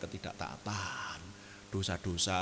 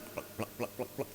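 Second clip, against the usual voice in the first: footstep sounds; 7 dB quieter than usual. Retold or imitated. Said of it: "langsung jalan gitu".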